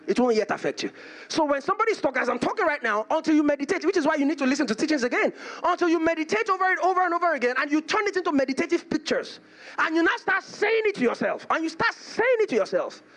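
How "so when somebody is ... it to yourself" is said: high, driving sermon delivery; impassioned preaching. Real, not acted. Preacher urges congregation to keep meditating, impassioned preaching. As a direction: press the teaching home in an insistent, raised pitch.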